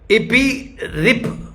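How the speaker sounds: इपिरिप drawn out syllable by syllable; very slow dictation. Shown as a demonstration. Preacher dictating to note-takers, very slow. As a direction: stretch इपिरिप out for writing. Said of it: "मैं धीरे-धीरे बोलूंगा".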